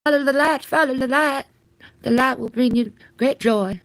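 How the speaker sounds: high ethereal spirit calling; mock ghost voice. Streamer luring chat as a ghost, high and ethereal. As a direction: beckon them like a spirit from beyond.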